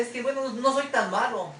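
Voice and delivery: mocking "I'm not so bad"; self-excusing quote. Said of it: "La gente dice".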